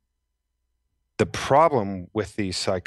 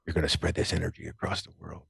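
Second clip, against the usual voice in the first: mysterious voice; 6 dB quieter, faster than usual. Retold or imitated. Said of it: "I hear this voice that goes".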